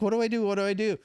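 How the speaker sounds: high-pitched panicky driver; mock panic. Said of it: "the wave runner driver's panicking".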